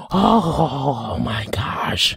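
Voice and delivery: breathless whispered oh my gosh; awed whisper. Real, not acted. Reviewer overwhelmed by the song, whispering. Overcome after favorite song.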